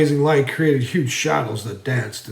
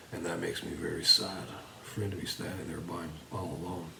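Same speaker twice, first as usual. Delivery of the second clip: low, very soft, fading narration; hushed sad aside; 6 semitones lower, 15 dB quieter, faster than usual. A narrator's reading. Narrator grieving for the master standing alone, hushed and sad. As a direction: drop low and almost under the breath.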